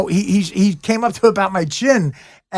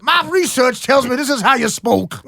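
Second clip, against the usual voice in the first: mock South African accent; 6 semitones higher than usual. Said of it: "his South African accent".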